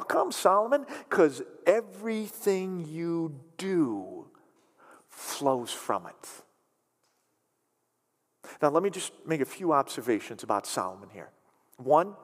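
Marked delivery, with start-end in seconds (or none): 1.1-6.4 slow solemn. Real, not acted. Preacher slowly finishes the verse.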